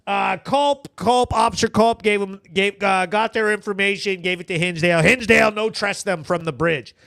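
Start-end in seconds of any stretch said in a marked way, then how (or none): none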